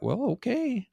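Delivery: a light mock shrug of defeat; lightly voiced grudging acceptance; resigned shrug. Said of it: "Were you just kinda like".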